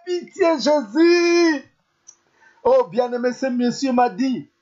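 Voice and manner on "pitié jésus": crying out for mercy. Shown as a demonstration. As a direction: shout to Jesus for pity. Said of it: "Tu peux crier".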